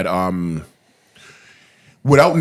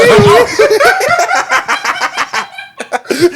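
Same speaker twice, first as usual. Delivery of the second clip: high laugh. Real, not acted. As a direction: burst of high-pitched laughter. Bursting out laughing.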